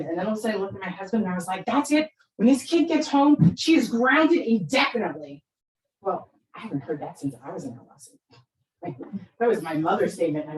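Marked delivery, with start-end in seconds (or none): 1.6-5.4 raised, higher voice of a fed-up mother; re-enacted parental outburst, laying down the law; angry ultimatum. Mother telling husband the kid is grounded, acted angry.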